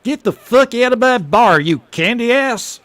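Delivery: high, loud furious yell; a bartender screaming a customer out of his bar; angry bartender. Acted. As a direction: throw him out of the bar at full volume. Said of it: "then gets angry".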